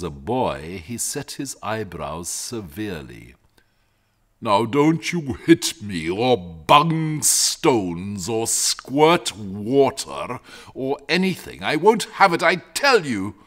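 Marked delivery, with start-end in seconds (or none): 4.4-13.4 loud, slow, huffy warning; a grumpy dragon laying down the law; indignant dragon. Acted. Dragon forbids the boy.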